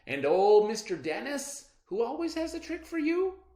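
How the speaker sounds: high, loud grandmotherly delight; a grandma brightening up; Nana's voice. A narrator's reading. Nana promising CJ a treat, high and loud.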